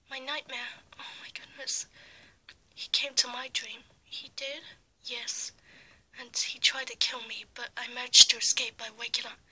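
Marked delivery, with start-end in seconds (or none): 0.1-5.5 hushed, frightened whisper; scared whisper. Acted. Scared whispered dream talk.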